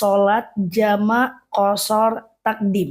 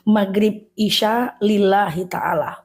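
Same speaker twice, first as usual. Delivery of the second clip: slow, careful recital of the intention; unhurried teaching recitation; reciting the niat; slower than usual. Shown as a demonstration. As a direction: model the words slowly so they can follow. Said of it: "Berarti niatnya adalah".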